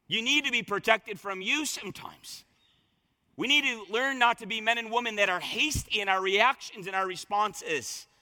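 usual speaking voice

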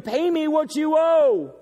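hammed-up angry creditor; angry demand. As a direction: demand the debt like a bully grabbing a throat. Servant demanding payment, throat grabbed.

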